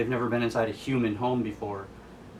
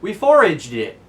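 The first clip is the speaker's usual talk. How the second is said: louder, higher boast on foraged; proud answer; 7 semitones higher, 9 dB louder than usual. Retold or imitated. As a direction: announce it proudly. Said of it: "And Vivi says".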